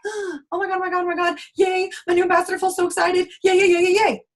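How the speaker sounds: an upline squealing over her new recruit; giddy squealing. Retold or imitated. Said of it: "I would be like".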